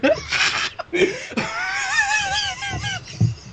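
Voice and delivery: very high howling laugh; high squealing laughter; shrieking laugh. Real, not acted. Wild laughter at the story.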